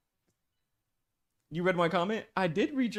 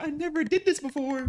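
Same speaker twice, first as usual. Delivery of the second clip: high, hammed-up fake sob voice; badly faked tears to win sympathy; mock crying; 11 semitones higher, 4 dB louder than usual. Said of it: "I'm gonna start crying".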